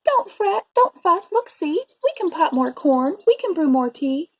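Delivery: high little mouse voice; squeaky mouse. A narrator's reading. Mouse comforting the sad bear, high squeak. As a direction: pipe up high to soothe the bear. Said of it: "The mouse squeaks".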